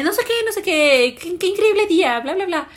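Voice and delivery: oblivious cheerful chatter. Retold or imitated. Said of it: "yo llego y te digo como".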